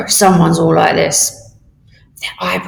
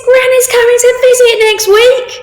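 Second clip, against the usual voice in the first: much higher, louder, thrilled; overjoyed after covid lockdown; very excited; 7 dB louder than usual. Shown as a demonstration. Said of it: "Very, very excitable".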